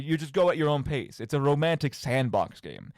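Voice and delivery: ordinary talk, nothing marked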